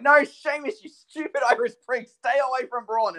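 high, loud yell at the screen; exasperated yelling. Retold or imitated. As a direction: plead angrily with a wrestler who can't hear. Acting out yelling at the match.

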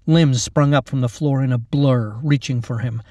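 ordinary delivery

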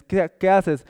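loud inquisitive interruption; curious caller. Acted. Acted caller in imagined exchange.

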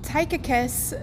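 ordinary talk

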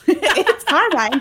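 slow, high, loud laughing guess; laughing it out slowly; laughing joke. Real, not acted. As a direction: giggle through a fake answer. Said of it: "joking guess, laughing".

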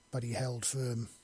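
ordinary delivery

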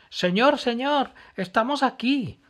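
high, loud call, rushing at the end. Acted. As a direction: shout across the flames to the man. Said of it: "gritó Emma".

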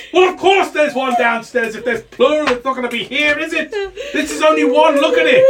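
high, loud incredulous wizard; exasperated wizard master. Acted. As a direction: berate your servant in rising exasperation.

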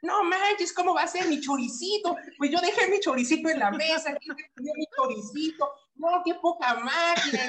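hammed-up tearful protest; retold bawling. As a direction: wail about the stolen sausage, half in jest. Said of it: "ahí sí ya iba berreando".

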